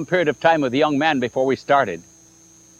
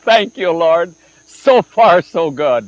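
joyful thanks; 6 dB louder, slower than usual. Retold or imitated. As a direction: cheer it to heaven. Joyful thanks after girl agrees.

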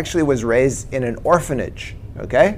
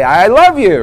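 louder, higher, forced-out retold confession; struggling to say it; 6 semitones higher, 7 dB louder than usual. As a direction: push the words out with mock effort. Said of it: "and I tried to say".

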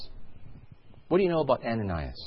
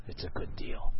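breathless whisper to yourself; whispered excuse; 6 dB quieter than usual. Retold or imitated. Shopper whispering to himself while the cashier totals up. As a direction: sneak the words out under your breath.

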